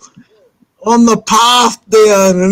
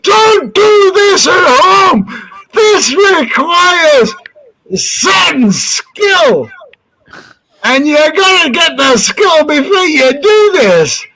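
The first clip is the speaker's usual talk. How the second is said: son's showman warning; 10 semitones higher than usual. Retold or imitated. Son warns crowd mid-ride, showman warning. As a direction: imitate a stuntman warning the crowd, pitched up.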